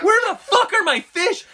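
high, loud yelp of disbelief, fish gone; outraged disbelief. Retold or imitated. Man finding his pond empty, shrieks high in outrage. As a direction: shriek it like the fish just vanished.

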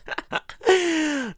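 high squealing laugh. Real, not acted. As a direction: burst out laughing at your own joke. Laughs at own insult joke.

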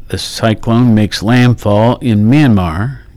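ordinary talk

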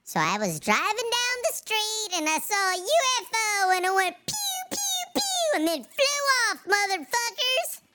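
high squeaky filter voice; Bobby's story in a chipmunk filter; mock Bobby. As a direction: ham up a silly retelling. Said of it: "Bobby tell her story".

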